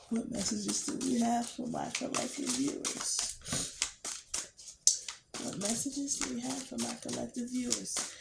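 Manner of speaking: soft, reverent call to Spirit; hushed invocation. Acted. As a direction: murmur a quiet prayer to Spirit. Calls on Spirit during the reading.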